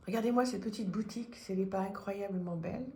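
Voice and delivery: ordinary talk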